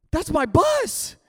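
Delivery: mock panic; rising panic. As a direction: stretch it out in alarm. Realising the bus is leaving.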